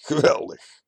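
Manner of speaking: a mild menacing cheer; laughing, still stern. A narrator's reading. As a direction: laugh it out while staying hard. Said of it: "Zonder van blik te veranderen lacht hij".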